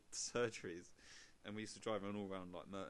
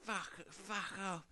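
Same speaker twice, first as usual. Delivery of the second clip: put-on slow, slurred drunk mumble; drunk man; 9 semitones higher, much slower than usual. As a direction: drag it out like a drunk bum. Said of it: "he's like, going".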